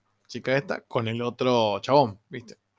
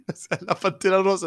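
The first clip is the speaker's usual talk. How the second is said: laughing disbelief; 5 semitones higher than usual. Real, not acted. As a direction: crack up at the absurdity. Cracking up at absurd sight.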